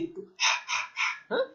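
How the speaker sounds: হা with the air pushed out and no voice; breathy ha demo. Shown as a demonstration. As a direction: breathe out হা from the throat. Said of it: "হাটা গলা মোটা". (হা শুধু বাতাসে, গলা থেকে)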